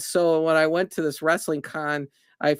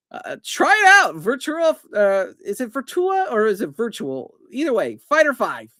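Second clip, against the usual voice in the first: high, loud eager push; excited; 6 semitones higher, 3 dB louder than usual. Real, not acted. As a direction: urge them on with excitement. Excited urging viewers to try.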